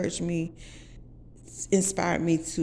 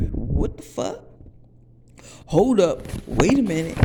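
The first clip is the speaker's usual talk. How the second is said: shocked; 2 semitones higher, 6 dB louder than usual. Retold or imitated. Shocked by his insult.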